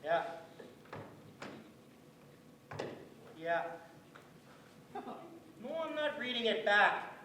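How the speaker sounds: bored, offhand receptionist with long pauses. Acted. Bored band office secretary fielding calls about the absent chairman, offhand and slow.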